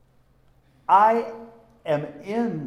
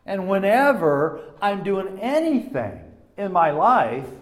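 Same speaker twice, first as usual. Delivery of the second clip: usual speaking voice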